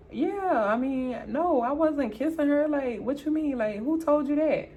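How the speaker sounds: high-pitched defensive protest; guilty denial. Retold or imitated. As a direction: deny it while sounding caught out. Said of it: "folks be guilty when they start saying".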